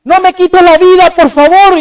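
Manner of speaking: imitating a pleading cry, high and loud; desperate plea. A person held at gunpoint begging not to be killed, loud desperate plea. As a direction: beg for your life at gunpoint. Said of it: "¿Qué es lo primero que gritan ellos?".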